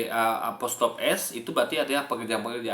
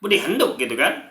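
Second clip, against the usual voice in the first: put-on Budi Handuk in a Bandung Sundanese accent, raised; 4 semitones higher, 4 dB louder than usual. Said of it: "orang-orang Bandung kan".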